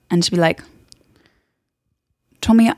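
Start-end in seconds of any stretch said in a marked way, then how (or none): 2.4-2.7 louder, higher attempted Irish accent; Saoirse Ronan. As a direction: try calling out in an Irish accent. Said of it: "No, she's like".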